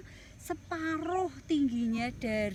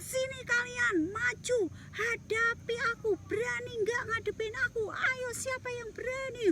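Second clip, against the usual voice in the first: Goliath shouting; 7 semitones higher than usual. Acted. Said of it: "Goliat berteriak-teriak".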